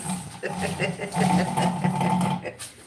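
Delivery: long, loud real laugh; hearty laughter. Real, not acted. Streamer laughing at own "some days" remark, hearty laughter. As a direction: laugh on and on, loudly.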